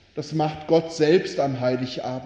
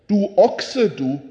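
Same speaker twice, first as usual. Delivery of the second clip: higher, louder rebuke of the ox; angry scolding; 5 semitones higher, 5 dB louder than usual. Acted. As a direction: snap at the ox for forgetting the child. Donkey scolds the ox.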